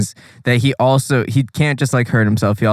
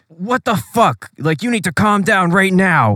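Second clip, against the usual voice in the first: lightly voiced, fed-up captain; mock Levi; 8 semitones higher than usual. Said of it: "Levi is just like".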